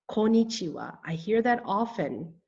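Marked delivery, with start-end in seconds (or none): 0.1-1.0 "Konnichiwa" as quick ko-ni-chi-wa, four syllables; missing first N. Shown as a demonstration. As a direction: say "Konnichiwa" wrongly, dropping the first n. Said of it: "it is missing the first N".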